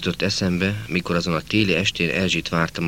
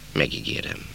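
whispered promise; 7 semitones lower, 6 dB quieter than usual. Acted. Boy promises his teacher, ashamed.

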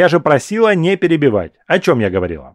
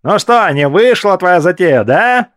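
higher, louder squeaky taunting yell; 5 semitones higher, 3 dB louder than usual. Acted. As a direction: jeer in a shrill-pitched thin voice. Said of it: "орал мне в лицо худой парень писклявым голосом". (писклявый крик в лицо)

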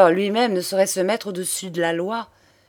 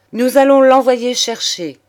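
decisive; 5 semitones higher, 9 dB louder than usual. A narrator's reading. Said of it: "continua monsieur de Commarin".

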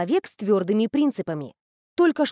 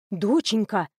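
usual speaking voice

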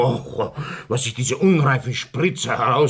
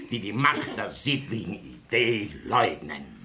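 ordinary talk, nothing marked

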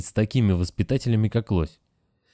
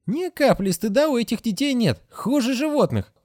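much higher, indignant scolding; angrier nurse. A narrator's reading. Nurse Agatha complains to the Moose, high and angry. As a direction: spit out the disgust. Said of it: "Сестра сделалась ещё злее".

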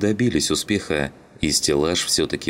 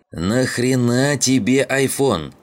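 higher, louder, slow incredulous exclamation; exclaiming; 8 semitones higher, 4 dB louder, slower than usual. A narrator's reading. Zhigan exasperated at Shum for bringing an iPhone, loud exclamation. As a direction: spell out the disbelief with a loud raised cry. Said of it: "воскликнул он".

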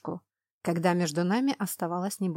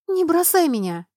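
desperate cry; 9 semitones higher, 7 dB louder than usual. Acted. Sharlin pulled away, begging.

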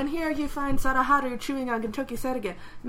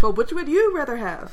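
louder, punchy ad read; commercial voice; 3 semitones higher, 7 dB louder than usual. Shown as a demonstration. Asked by co-host to redo the line as a commercial, louder ad read. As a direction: pitch it like a TV spot. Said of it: "make it sound like a commercial".

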